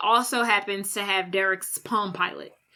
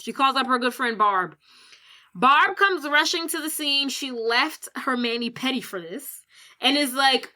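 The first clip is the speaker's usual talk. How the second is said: normal talking voice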